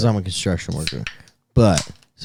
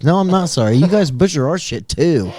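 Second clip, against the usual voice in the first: defiant; 7 semitones higher, 5 dB louder than usual. Pretend. Defiant retort to imagined Italians.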